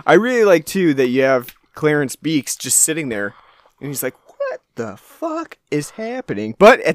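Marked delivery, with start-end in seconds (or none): 4.4-6.6 high, soft, slow mock bewilderment; stunned disbelief. Voicing a passenger just sitting there, stunned and slow. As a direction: draw it out in hushed, high disbelief.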